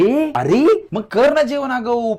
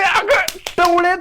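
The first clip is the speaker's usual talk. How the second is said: drawn-out scolding; 7 semitones higher, much slower than usual. Acted. Same scolding repeated, drawn out.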